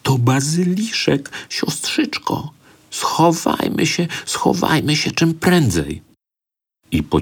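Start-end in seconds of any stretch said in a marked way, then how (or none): 0.0-6.0 frightened whisper. A narrator's reading. Boy whispers to his sister, frightened. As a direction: hiss it so the monster won't hear. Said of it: "szepnął drżącym głosem".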